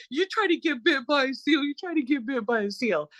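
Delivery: high imitation of a scared brother, sinking at the end; retold terrified protest. Sibling mimics brother terrified of the seal, high-pitched protest. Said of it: "like, terrified of that".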